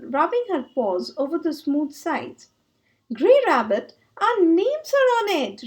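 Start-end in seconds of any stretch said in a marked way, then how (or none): 3.1-5.7 loud, high shout of discovery; excited shout. Acted. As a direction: call out in delight. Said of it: "shouted Hare".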